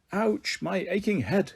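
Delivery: groggy professor waking with a sore head; lightly voiced dazed professor; Professor Stupido voice. Professor wakes up groaning.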